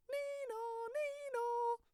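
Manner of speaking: very high siren wail sung; high nee-naw siren sound; siren imitation. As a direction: imitate a fire truck siren.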